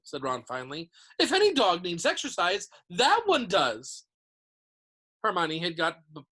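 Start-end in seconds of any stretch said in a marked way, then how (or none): 1.2-4.0 indignant. A narrator's reading. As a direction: grumble emphatically about the beast. Ron outraged about the monster dog.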